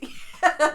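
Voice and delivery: burst of laughter. Real, not acted. Laughing mid-story.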